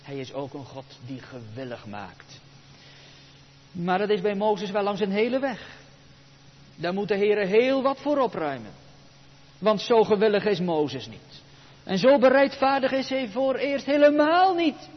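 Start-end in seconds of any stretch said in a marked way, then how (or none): none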